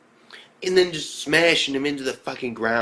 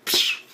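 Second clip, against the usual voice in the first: imitated hissing puff; smoke bomb noise. Retelling a student's smoke bomb escape, imitated puff. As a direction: make a smoke bomb burst with your mouth.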